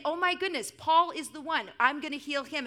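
loud, high-pitched delight; excited, emphatic declaration; gushing excitement. Acted. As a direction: gush as if you have found your favourite. Acting out God's excited favouritism.

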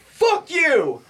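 high-pitched mock shout; retold angry yell. Brother throwing shorts on the street, angry yell. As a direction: hurl it out high, drawn out.

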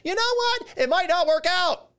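very high, sarcastic realization; exaggerated high "aha" sarcasm; mock revelation. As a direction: act like it's a brand new idea. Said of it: "say to yourself".

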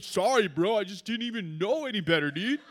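hint of a doofus impression; lightly voiced goofy, apologetic bro; Kyle. Teammate apologizing for shooting his friend, lightly mocked doofus voice. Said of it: "this is exactly how Kyle sounds".

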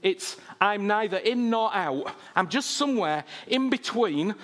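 usual speaking voice

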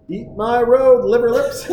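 high, loud movie-dad taunt; Clark. Retold or imitated. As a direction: crow it at the car you're passing. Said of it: "he's gonna pass him again".

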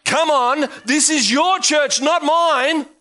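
builds to a shouted climax; rising preaching. Acted. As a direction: pastor firing up his church.

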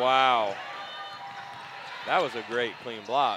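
loud, amazed reaction to a block. Real, not acted. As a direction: wow it out, truly impressed.

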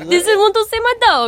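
high, loud mock German accent; failed German accent. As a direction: mimic a botched German accent, loudly. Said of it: "that's not a German accent".

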